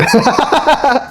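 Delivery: amused laugh after the joke; high laugh. Real, not acted. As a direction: crack up at the punchline. Laughing at the punchline.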